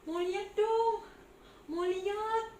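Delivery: high, loud eager cry; eager pleading. Acted. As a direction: beg to see over the crowd. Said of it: "coba loncat-loncat".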